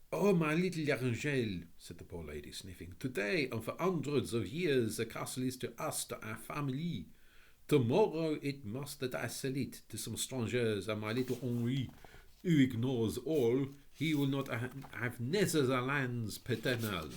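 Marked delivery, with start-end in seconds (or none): 0.1-1.7 put-on high, French-accented lady's welcome. A narrator's reading. Tearful French lady. 3.0-17.1 put-on high, French-accented lady's welcome. A narrator's reading. Tearful French lady explains.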